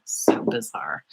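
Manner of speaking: breathy puzzled whisper; whispered aside. Real, not acted. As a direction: whisper it to yourself, baffled. Puzzled aside about missing brush.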